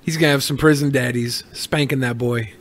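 usual speaking voice

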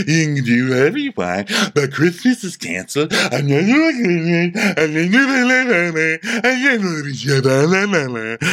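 put-on drunken slurring rant; Dorinda. Drunk in the hallway cancelling Christmas, slurred and sweeping. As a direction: slur it, swooping up into gibberish. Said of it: "Dorinda stumbling in the hallway".

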